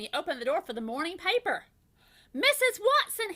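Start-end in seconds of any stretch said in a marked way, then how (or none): 2.3-3.3 high, louder call to his wife; husband calling from the doorway. A narrator's reading. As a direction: call her over from the door. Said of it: "he called".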